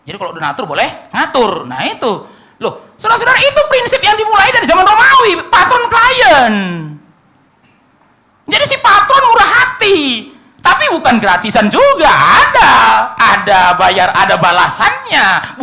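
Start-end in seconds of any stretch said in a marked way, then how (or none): 2.6-10.0 high, loud, ringing pulpit cry; full-force preaching. Real, not acted. 10.6-15.6 loud, punchy insistence; emphatic shout. Real, not acted. Emphatic payoff point.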